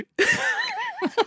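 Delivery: shrill laugh. Real, not acted.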